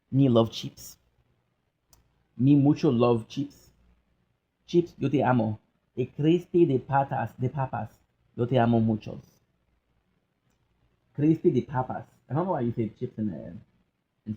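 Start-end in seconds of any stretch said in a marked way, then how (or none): 0.1-12.0 hammed-up Spanish accent; mock Spanish accent. Joking to viewers about chips in a mock Spanish accent. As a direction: ham up a fake Spanish lilt.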